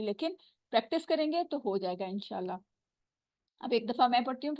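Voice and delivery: ordinary talk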